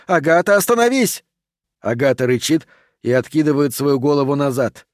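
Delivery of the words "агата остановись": high, urgent order, rising sharply; shouting command. A narrator's reading. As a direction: cry out to stop someone, voice climbing. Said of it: "кричит профессор Прайс". (отчаянный окрик на высоких нотах)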